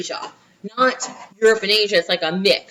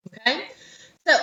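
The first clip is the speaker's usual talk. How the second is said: normal talking voice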